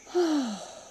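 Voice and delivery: demonstrative sigh, starting high and falling; releasing sigh. Shown as a demonstration. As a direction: let it all go in one audible exhale. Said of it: "maybe making a sound".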